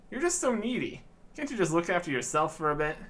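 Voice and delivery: ordinary delivery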